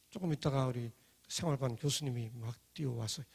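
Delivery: usual speaking voice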